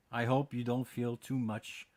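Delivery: normal talking voice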